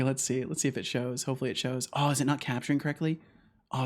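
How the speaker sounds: ordinary talk, nothing marked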